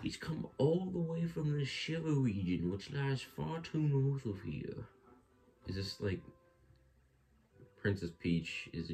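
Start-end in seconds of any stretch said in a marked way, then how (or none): 0.0-4.9 put-on high, slow old man toad. Toad character greeting Mario.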